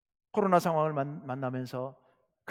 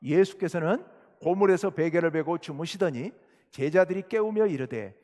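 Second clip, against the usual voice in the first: usual speaking voice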